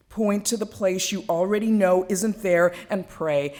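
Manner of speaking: lively, swelling poetry performance; spoken-word poem, rising and falling with the tale. Acted. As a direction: tell it like a story to a full room. Performs her second poem.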